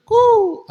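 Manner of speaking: high hooting coo, like hide and seek; playful high-pitched hiding call. Retold or imitated. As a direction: call out a sharp high koo. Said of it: "అంటాడు".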